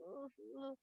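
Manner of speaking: very soft, nervous moan; squeamish whimper. Retold or imitated. Moshe told to pick up snake by tail, squeamish. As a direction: whimper reluctantly under your breath.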